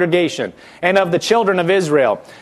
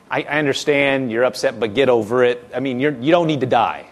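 calm reassurance; 7 semitones lower, faster than usual. Retold or imitated. Answering a friend who wants to die, low and calm. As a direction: talk them down gently. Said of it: "You're like".

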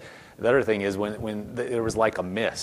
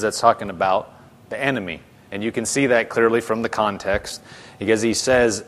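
ordinary talk, nothing marked

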